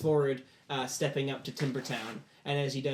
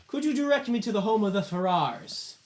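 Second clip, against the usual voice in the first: high, loud lordly enquiry; pompous lord; 8 semitones higher, 7 dB louder than usual. Acted. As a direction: project a grand visitor's request. Lord addresses a villager aloud.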